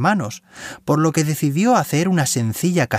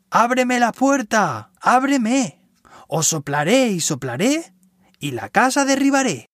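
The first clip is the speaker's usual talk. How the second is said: wolf voice; 8 semitones higher than usual. A narrator's reading. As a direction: bark it out like a hungry wolf. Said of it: "dijo el lobo feroz". (voz de lobo feroz)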